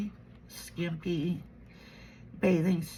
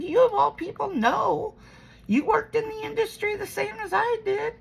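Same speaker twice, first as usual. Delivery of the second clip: much higher, urgent appeal to mom; exasperated pleading; 12 semitones higher than usual. Retold or imitated. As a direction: press the point, voice up high. Retells arguing with her mom.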